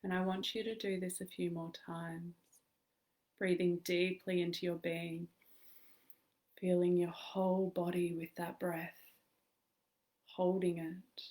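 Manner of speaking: calm meditation guide with long pauses; measured, soothing guidance in short phrases; guided meditation. Acted. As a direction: lead listeners gently into stillness, leaving space to breathe.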